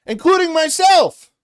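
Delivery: pitched high, shouted admission; shouting out that you're to blame too; real shout. Real, not acted.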